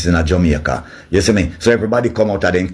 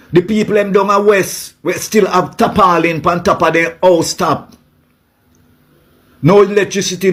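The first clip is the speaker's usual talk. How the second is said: heated high-pitched rant; 7 semitones higher than usual. Real, not acted. Ranting to viewers about stolen money and the sick, heated and high-pitched. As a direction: pitch climbing high with outrage.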